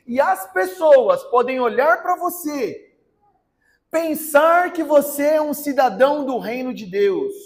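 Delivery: forceful, driving sermon delivery; fervent preaching. Acted. Preacher urges churchgoers that they must be born again, fervent preaching. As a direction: press every line home to the congregation.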